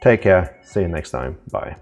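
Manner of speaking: usual speaking voice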